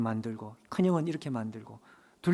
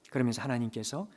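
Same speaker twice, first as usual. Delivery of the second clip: ordinary delivery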